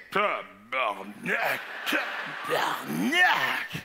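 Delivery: very slow, low swearing; low slow-motion curse; echoing murmured curses. Acted. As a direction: draw out each syllable in shocked disbelief. Curses heard across the store.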